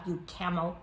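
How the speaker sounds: plain reading voice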